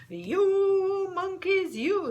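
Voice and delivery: high loud slow scolding shout; scolding monkeys. Acted. Peddler scolding the monkeys for his caps, loud and high. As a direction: wag a finger and scold. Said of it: "This made the peddler angry".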